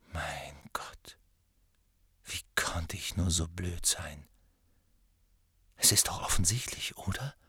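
whispered realisation. A narrator's reading. Harry realising by the fire, whispering to himself. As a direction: whisper it to yourself as it dawns.

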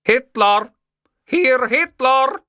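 high, loud phone shout. A narrator's reading. Man answering a phone that only plays music, shouting. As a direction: call out, stretching the words high.

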